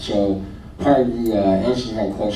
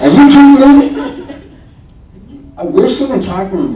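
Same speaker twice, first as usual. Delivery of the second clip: exasperated outburst; 11 dB louder than usual. Retold or imitated. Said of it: "I was so mad".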